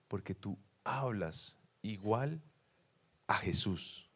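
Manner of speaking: lento, suave y más grave; énfasis pausado. Retold or imitated. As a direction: repite la frase despacio, bajando la voz. Repite la frase clave con énfasis. (en voz baja y pausada)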